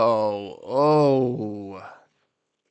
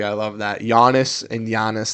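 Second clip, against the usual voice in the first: normal talking voice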